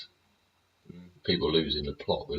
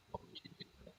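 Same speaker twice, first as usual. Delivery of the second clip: whispered, barely voiced disbelief; incredulous whisper; 23 dB quieter, much faster than usual. Real, not acted. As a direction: breathe the question out to yourself in disbelief. Repeating the question, fading to whisper.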